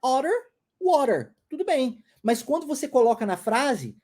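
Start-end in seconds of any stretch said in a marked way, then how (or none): none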